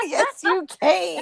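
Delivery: high, slow, delighted echo through laughter; laughing echo. Real, not acted. Friend repeating the cane pun back, laughing. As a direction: laugh it out, stretching "can" into "cane".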